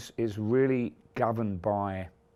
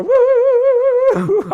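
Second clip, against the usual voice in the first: shrieked high woo; a wild high cheer from the drum riser; Davey's woo; 16 dB louder than usual. Retold or imitated. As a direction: whoop it out, high and loud. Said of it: "And Davey still go".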